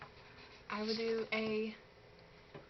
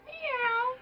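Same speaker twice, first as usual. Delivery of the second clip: high meow; 12 semitones higher than usual. Shown as a demonstration. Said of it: "Now, the Chinese".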